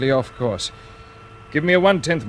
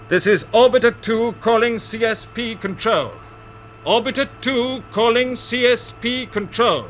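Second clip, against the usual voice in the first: higher, slow radio call trying to get through; 7 semitones higher, slower than usual. Acted. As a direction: hail the base clearly, a little raised. Pilot calling control blindly.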